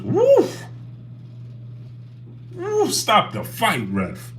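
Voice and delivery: high yelped woofs, slow call; mock fight reaction. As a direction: imitate a stunned call to the ref.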